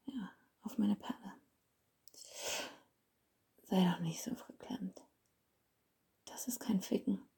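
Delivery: seductive hushed whisper. Acted. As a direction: coax him in a whisper. Holds a whisper.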